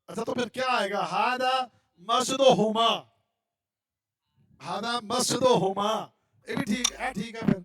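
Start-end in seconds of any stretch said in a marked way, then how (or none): 1.0-6.1 each word dragged out slowly and carefully; slow student reading. Pretend. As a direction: read it like a hesitant pupil. Teacher playing a student reading.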